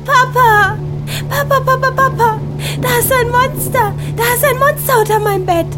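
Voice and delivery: put-on panicked high child cries; scared child calling. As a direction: call out for Papa in fear. Anna screams for father.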